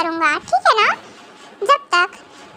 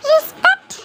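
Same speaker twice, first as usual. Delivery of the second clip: high, disbelieving question; incredulous echo; 8 semitones higher than usual. Acted. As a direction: throw the word back in disbelief. Husband mocks wife's respect claim.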